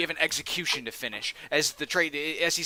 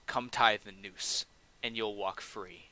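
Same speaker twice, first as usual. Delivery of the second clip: slow, softening grim bargain; drawn-out cold offer of freedom; slow menacing offer; 3 semitones lower, 4 dB quieter, slower than usual. Acted. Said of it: "he basically looks to you and says".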